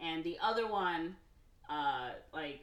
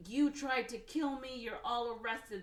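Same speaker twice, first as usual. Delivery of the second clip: mock indignant accusation, pitch raised; retold outrage; 6 semitones higher than usual. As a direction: imitate an affronted boss ordering arrests. Attacked boss orders them arrested.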